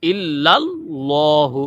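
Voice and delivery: ইল্লাল্লাহু slow, with a thick l; slow heavy ইল্লাল্লাহু. Shown as a demonstration. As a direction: draw out ইল্লাল্লাহু. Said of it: "ল হবে".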